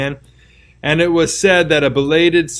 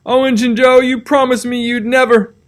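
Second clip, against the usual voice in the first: high, louder shocked outcry; exclaiming; 10 semitones higher, 4 dB louder than usual. Acted. Potter cries out to Injun Joe, high and shocked. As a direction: cry out in betrayed disbelief.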